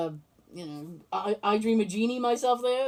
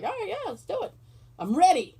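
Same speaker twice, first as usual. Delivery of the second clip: eager yes; 8 semitones higher than usual. Retold or imitated. Eager reply to friend's plan.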